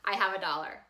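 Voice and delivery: fast, eager inner thoughts; excited inner voice. Retold or imitated. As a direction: rush through the giddy thoughts. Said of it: "I'm thinking".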